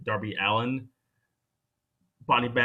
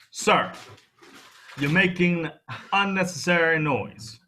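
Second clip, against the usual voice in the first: mock stern scolding; 6 semitones higher, 4 dB louder than usual. Scolding co-host for desk noise.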